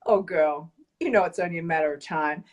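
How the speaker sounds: low, sultry come-on; seductive cake voice. Pretend. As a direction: tempt her like a lover in the dark. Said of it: "Imagine me saying this in, like, a really deep, sexy voice".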